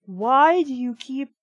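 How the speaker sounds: normal talking voice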